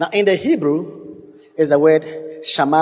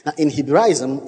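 normal talking voice

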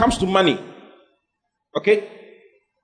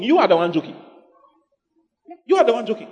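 ordinary talk

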